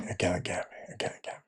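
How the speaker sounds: breathy whispered aside to himself. Real, not acted. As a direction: whisper it under your breath, twice over. Whispering to self on stream.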